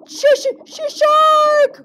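stuttering, very high, loud alarm; stammer, then shriek the warning; terrified cry. Acted. Maxwell warns of a shark, high stuttering terror. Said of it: "cried Maxwell".

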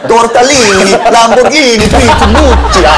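mock Italian gibberish, laughing. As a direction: ham up a fake Italian. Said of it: "이렇게 해서".

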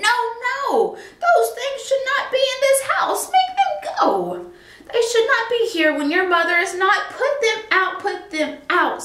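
high, alarmed fish voice, rising to a squeal; a panicked fish demanding the things leave. A narrator's reading. The fish in the pot orders the cat to put them out, squealing. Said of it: "said the fish in the pot".

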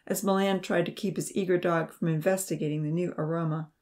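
plain reading voice

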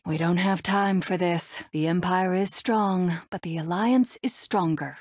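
ordinary talk

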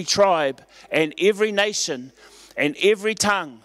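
rising sermon cadence with pitch lifted; preaching. Acted. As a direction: lift it up like a pulpit proclamation. Raised preaching passage.

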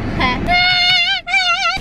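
bright high-pitched giggle; high laugh. Real, not acted. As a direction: burst into a squealy laugh. Laugh before thrift haul.

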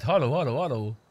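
usual speaking voice

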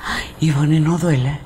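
breathy unvoiced question, sympathetic; hushed whisper. Real, not acted. Host whispers to Ivonne, wincing at her pain.

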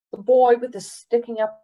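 plain reading voice

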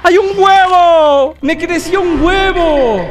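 high, drawn-out amazement; astonished exclamation. Real, not acted. Gamer finding an egg on screen, astonished cry. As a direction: stretch it out high in wonder.

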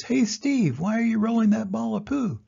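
high, bright-eyed animal calling out; curious elephant. Acted. Elephant calls to Steve the beetle, high and curious. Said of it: "The African elephant says".

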